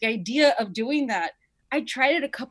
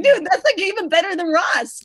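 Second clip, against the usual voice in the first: high, loud, giddy imitation; excited mock quote; 8 semitones higher, 9 dB louder than usual. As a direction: squeal it like an overexcited kid. S1 mocks S0's inner voice, laughing.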